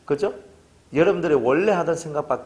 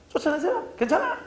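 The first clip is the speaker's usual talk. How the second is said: much higher, light voice saying it's fine; high reassurance. Retold or imitated. Pastor quoting himself permitting the eviction, high. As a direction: lift way up, permissive and easy. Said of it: "그래서".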